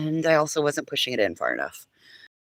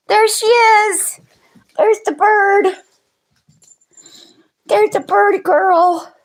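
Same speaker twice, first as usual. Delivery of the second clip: very high, loud pet talk; coaxing a wild bird; 8 dB louder than usual. Real, not acted. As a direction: sweet-talk the dog in a squeaky voice.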